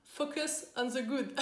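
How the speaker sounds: slow English with a French accent; English in a plain French accent, drawn out. Shown as a demonstration. Quoting the English saying, French accent. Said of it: "avec mon super accent".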